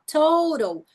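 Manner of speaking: slow English demo; long O. Shown as a demonstration. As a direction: stretch the o in "total". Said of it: "Som longo da letra O".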